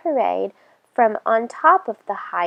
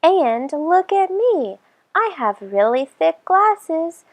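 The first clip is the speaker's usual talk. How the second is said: put-on higher kid voice, a little slower; another child's voice; 7 semitones higher than usual. A narrator's reading. Second child joining in for Rumple, pitched higher. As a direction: chime in as a second eager child.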